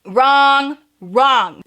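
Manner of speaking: loud repeated "Wrong, wrong"; loud emphatic denial. Real, not acted. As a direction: slam down "wrong" twice. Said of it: "you know?".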